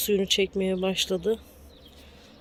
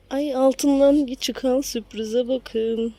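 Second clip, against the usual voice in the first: surprised; 5 semitones higher, 7 dB louder, much slower than usual. Real, not acted. Surprise at find.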